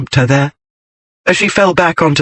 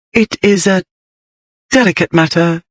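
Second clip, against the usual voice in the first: higher, halting and shy; hesitant, shy; 4 semitones higher than usual. A narrator's reading. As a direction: pause before the awkward word, timidly. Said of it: "her voice hesitant, shy".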